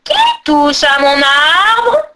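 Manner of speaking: slow, loud, drawn-out growl; growling ogress. A narrator's reading. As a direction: bellow menacingly like a child-stealing hag. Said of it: "gronda l'horrible Bouma".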